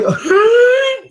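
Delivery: loud, sustained high-pitched squeal; high, held squealing noise. Real, not acted. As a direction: let out a long piercing squeal. Sudden noise amid hello checks.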